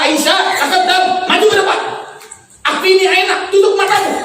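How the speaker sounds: high-pitched stern summons; mock-angry husband ordering his wife closer; imitated anger. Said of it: "karena Rasulullah marah". (perintah tinggi pura-pura marah)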